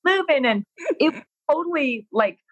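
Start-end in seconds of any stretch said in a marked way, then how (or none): 0.1-0.4 much higher, bubbly cheerleader voice; rally lazy sunbathers like an over-the-top fitness instructor; peppy instructor hype. Retold or imitated. Hyping beach guests into aerobics.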